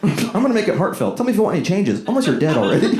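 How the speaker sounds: high, chirpy AI assistant; breezy helpful robot; chatbot voice. Retold or imitated. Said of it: "I like how the robot says that".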